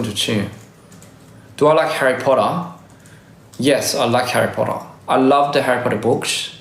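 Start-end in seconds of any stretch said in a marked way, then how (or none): none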